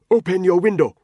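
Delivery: put-on loud, high Nigerian-accented command; angry order. Ntoga ordering Tony, loud angry command. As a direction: bark the order.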